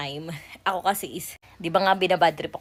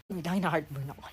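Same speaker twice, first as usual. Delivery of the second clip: normal talking voice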